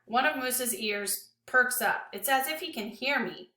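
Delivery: plain reading voice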